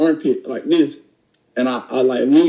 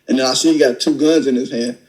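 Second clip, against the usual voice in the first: ordinary talk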